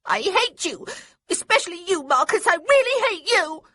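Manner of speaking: high, loud furious yelling; a girl screaming at both boys; yelled. A narrator's reading. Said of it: "And then she yelled it".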